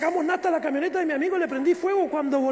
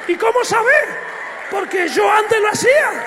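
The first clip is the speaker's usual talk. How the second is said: shouted testimony climax; loud preaching; 4 semitones higher, 6 dB louder than usual. Real, not acted. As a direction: hit the crowd with it, full voice. (testimonio gritado con fuerza)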